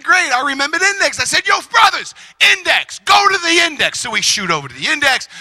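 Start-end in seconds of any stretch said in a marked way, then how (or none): 1.5-4.0 high, raised call to the brothers. Retold or imitated. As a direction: announce a breakthrough to the whole group. Calls out his discovery to friends.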